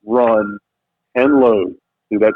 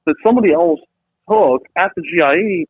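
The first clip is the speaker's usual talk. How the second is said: usual speaking voice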